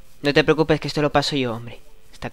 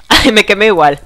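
laughing; 6 semitones higher, 10 dB louder than usual. Real, not acted. Burning, laughing.

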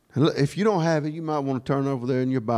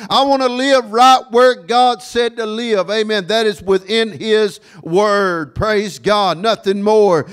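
high, loud preacher's cadence; full-force preaching; 8 semitones higher, 6 dB louder than usual. Real, not acted. Preacher vowing to live within God's Word, full-force preaching. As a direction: declare it with ringing conviction.

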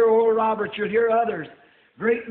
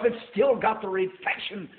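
normal talking voice

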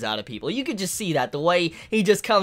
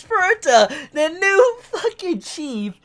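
laughing; 9 semitones higher, slower than usual. Real, not acted. Laughing at Furuta's entrance.

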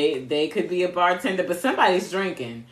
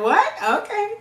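much higher, louder, startled; mock surprise; 14 semitones higher, 5 dB louder than usual. Reader acting her reaction to cards, mock surprise. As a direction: play your own shocked double take.

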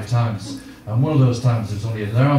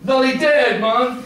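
blurted Jamaican voice; 12 semitones higher, much slower than usual. Acted. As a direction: blurt bad news bluntly, high and sudden, Jamaican accent. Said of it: "then the voice came".

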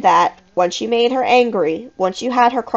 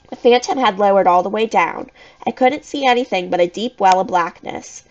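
plain reading voice

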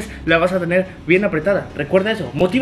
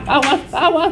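shouting; 9 semitones higher, slower than usual. Real, not acted. Calling out for water.

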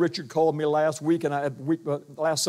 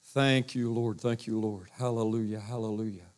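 soft, hushed thanks to the Lord, much quieter; murmured prayer; 7 semitones lower, 7 dB quieter than usual. Real, not acted. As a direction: breathe the praise almost under your breath.

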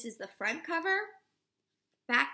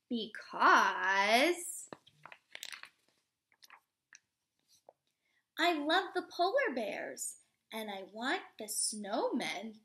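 slow, expressive storybook read-aloud; reading to children; 3 dB louder, slower than usual. Acted. Teacher reading a picture book to children, expressive read-aloud. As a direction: read it warmly to a class of little kids.

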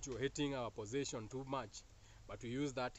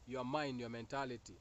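ordinary delivery

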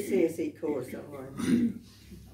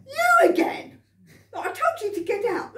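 much higher, louder outraged shout; exasperated butcher; 14 semitones higher, 6 dB louder than usual. Acted. Butcher shouting the cheeky rabbit out of his shop. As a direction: blow up at the pest back again.

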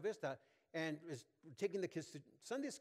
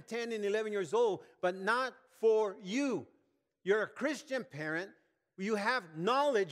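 loud, raised-pitch exhortation; preaching at full force; 7 semitones higher, 9 dB louder than usual. Real, not acted. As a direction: drive it home to the congregation, loud and emphatic.

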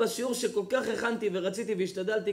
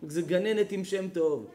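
ordinary talk, nothing marked

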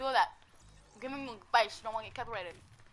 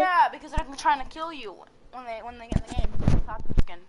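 loud excited; 8 dB louder than usual. Real, not acted. Excited explanation.

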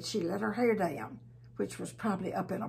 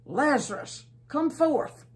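loud, high call that rises on come forth; big calling voice; 6 semitones higher, 7 dB louder than usual. A narrator's reading. Jesus calling Lazarus out of the tomb, loud high call. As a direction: call him out of the grave at full volume. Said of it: "he called out in a big voice".

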